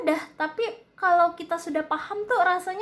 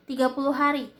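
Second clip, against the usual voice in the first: ordinary delivery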